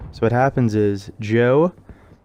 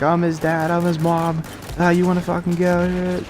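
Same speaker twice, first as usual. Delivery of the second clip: higher-pitched mock challenge to fight; parents picking a fight; 7 semitones higher than usual. Joe's parents challenging the other parents, mock fight-picking. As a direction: puff up like a hockey dad itching to brawl.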